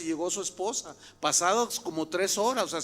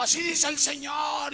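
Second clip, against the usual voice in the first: mock prophesying; 7 semitones higher than usual. Woman interrupts the sermon to prophesy, imitated high proclamation. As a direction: imitate a self-styled prophetess calling out.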